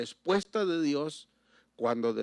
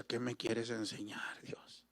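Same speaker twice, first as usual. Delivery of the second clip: soft, fading to a whisper on Dios; a broken, hushed plea through tears; imitating his own tearful prayer, a touch of trembling; 3 semitones lower, 10 dB quieter than usual. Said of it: "Y yo con lágrimas en mis ojos y temblando".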